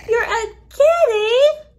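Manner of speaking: playful mock surprise. Teacher to a child pretending to be a cat, mock amazed. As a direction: act amazed the child is a kitty.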